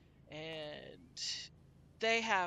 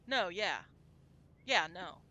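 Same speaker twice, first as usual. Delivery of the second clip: emphatic; 6 dB louder, slower than usual. Shown as a demonstration. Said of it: "And it's".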